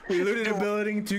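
high-pitched, laughing recap; incredulous and cracking up; real laughing. Real, not acted. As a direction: explain it through laughter. Recapping the absurd looting while laughing.

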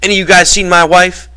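loud, high, rapid demand. A narrator's reading. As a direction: burst in and demand an answer, agitated. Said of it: "he demanded".

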